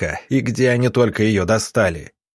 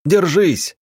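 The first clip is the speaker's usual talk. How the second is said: high-pitched, urgent cry; shouted warning; 8 semitones higher, 3 dB louder than usual. A narrator's reading. Rower to his companions as the boat jolts, shouted warning. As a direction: call it out sharply over the noise. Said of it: "крикнул он".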